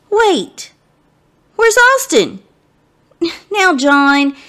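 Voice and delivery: ordinary delivery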